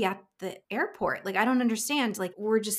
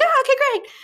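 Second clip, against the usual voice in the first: very high, loud cheer; excited squeal; 10 dB louder than usual. Retold or imitated. Producer and colleague on phone react to good news, high excited squeal. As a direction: squeal it back like an excited teammate. Colleagues scream back in relief.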